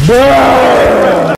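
loud high yell of disbelief; frustrated shout. Real, not acted.

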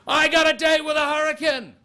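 put-on high, loud, giddy drunk girl voice; drunk party girl. Reporter squealing about a date with a hurricane, drunk party girl. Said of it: "You sound like some drunk chick from Taita".

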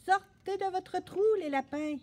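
high, hollered command; bawling. A narrator's reading. Boy calling rabbits out of their holes, bawling. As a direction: holler it out to hidden animals. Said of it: "brailla Gaspard".

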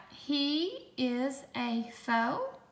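slow, word-by-word dictation; slow, clear dictation voice; dictation pace. Shown as a demonstration. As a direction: read it out slowly for spelling. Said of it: "Did you write".

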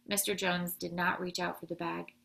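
ordinary delivery, nothing marked

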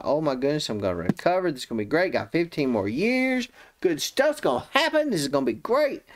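imitating a happy man, pitch climbing high; ecstatic. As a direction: gush with mounting relief and delight. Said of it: "then he's ecstatic. He's happy about this".

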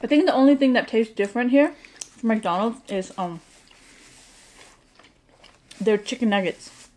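chewing while talking; talking around food; mouth full. Real, not acted. Seen 2: Woman holds fries, lips pursed and working between words; ate fries before.

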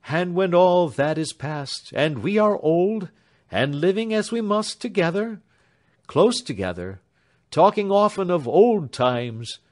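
mean every word of the farewell; lightly voiced young man. A narrator's reading. Alfred to his beloved at parting, earnest.

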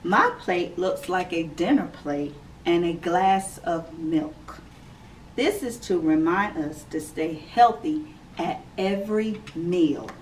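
clear, measured read-aloud voice; gentle storybook reading for kids; reading to children. Acted. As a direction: read it out like story time for little ones. Reads picture book to children.

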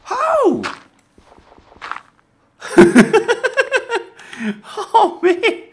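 high, loud shocked cry into laughter; startled laugh. Real, not acted. Vlogger recovering from a lightning strike, shocked laughing. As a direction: burst out laughing from the scare. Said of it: "That gave me a genuine heart attack".

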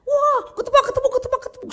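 very high, loud shriek; imitated high-pitched screaming; mock panicked scream. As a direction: screech it out like someone in a panic. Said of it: "kok teriak-teriak".

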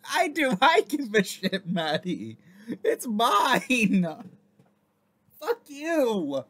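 high, loud playful yelling; mock shouting. Answering a character in her own story, mock shouting. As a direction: shout back at the story in jest.

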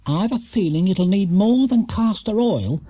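high-pitched ominous remark. A narrator's reading. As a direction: warn with a touch of foreboding. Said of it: "said Missus Bird ominously".

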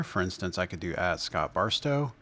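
ordinary talk, nothing marked

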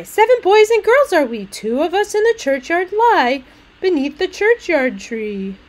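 high, loud sing-song verse; little maid. Acted. Little maid insisting they are seven, high and sing-song. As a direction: chirp it out as a cheerful child. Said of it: "Then did the little maid reply".

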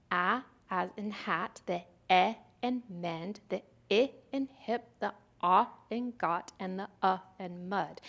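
a, e, I, ah, uh as the short vowels of hat, mend, hip, got, mud; short vowel sounds. Shown as a demonstration. Teacher giving examples of closed syllables, short vowels in a, e, I, ah, uh. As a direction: isolate each short vowel, a, e, I, ah, uh, before its example word. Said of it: "going to make the short sound".

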